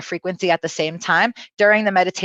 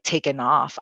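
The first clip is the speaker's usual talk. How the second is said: ordinary delivery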